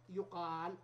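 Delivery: يقال slowly as yuqaal with a long aa; slow careful yuqaal for يقال; passive pronunciation. Shown as a demonstration. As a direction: draw out the aa of يقال. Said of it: "نقلب الواو أو الياء إلى ألف".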